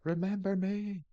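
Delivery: high eerie ghostly whisper-voice; haunt them with a ghostly call; spooky ghost voice. Pretend. Spooky voice in horror game.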